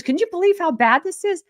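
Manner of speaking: a hint of an outraged eighty-year-old woman; old lady indignant. Retelling the old lady filming skies, lightly voiced high indignation. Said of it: "an eighty-year-old lady".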